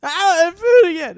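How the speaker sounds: put-on high, loud, slow baby demand; shrieking infant insists, slowly and loudly. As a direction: wail like a hungry baby. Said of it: "he goes".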